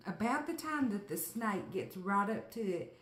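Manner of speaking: ordinary talk, nothing marked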